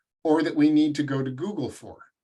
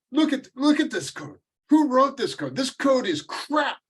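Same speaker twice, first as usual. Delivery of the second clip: mock Russian coder griping in a thick Russian accent, much higher; bad Russian accent; 12 semitones higher than usual. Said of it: "I'm gonna do this Russian accent horribly".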